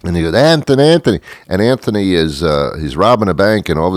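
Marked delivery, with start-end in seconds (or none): 0.3-1.2 high-pitched imitation of a mother calling; mock yell. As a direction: call out the name, pitched way up. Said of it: "and she yells".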